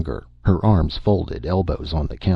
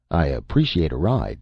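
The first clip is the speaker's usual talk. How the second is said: ordinary delivery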